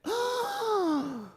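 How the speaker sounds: sharp high gasp of shock; a sudden startled intake of breath. Real, not acted. Shock at news of stroke.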